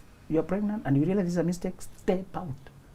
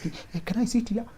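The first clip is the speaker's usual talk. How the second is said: soft, slightly high hesitant question; tentative little request; unsure, timid asking; 5 semitones higher, 5 dB quieter than usual. Acted. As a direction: ask quietly, as if unsure you're welcome. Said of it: "You know, you're not sure".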